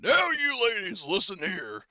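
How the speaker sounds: high, loud mock drill-sergeant bark. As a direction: holler it like a hammed-up sergeant. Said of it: "he's like".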